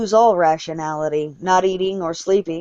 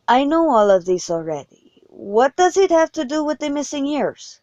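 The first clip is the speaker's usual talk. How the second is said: higher-pitched, mildly impatient cut-in; interrupting; 7 semitones higher than usual. A narrator's reading. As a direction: cut in on the speaker, pitch lifted. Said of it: "he said, interrupting me".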